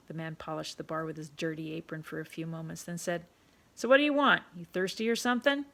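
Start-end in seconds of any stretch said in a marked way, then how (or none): 3.7-5.7 put-on loud, bossy barkeep calling the boy in; a pushy tavern keeper ordering a kid about; rough London publican, loud and commanding; gruff pub owner. Pub owner voiced in story.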